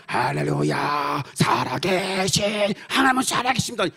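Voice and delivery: imitated revival-meeting shout; exultant praise. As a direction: cry out praise like a man just healed. Healed man shouting praise as he walks.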